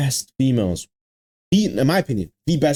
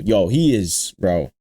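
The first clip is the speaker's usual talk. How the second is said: ordinary talk, nothing marked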